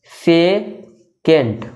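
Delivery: very slow secant, split into "से" and "Kent" with a pause between; syllable by syllable. Shown as a demonstration. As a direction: draw out secant in two separate beats for learners. Teacher spells out new term.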